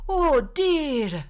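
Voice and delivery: high, loud, hammed-up nanny voice; Missus Doubtfire. Player joking for the table, Missus Doubtfire impression. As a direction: trill it out as a fussy nanny. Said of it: "consistently be Missus Doubtfire".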